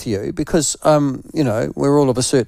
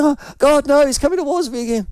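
high, fast mock alarm; imitating the woman's alarm; mock panicked woman; 11 semitones higher, faster than usual. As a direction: ham up her panic as he approaches. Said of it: "she was going".

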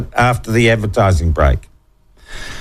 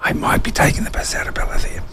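whispering; 5 dB quieter than usual. Real, not acted. Aside off-mic.

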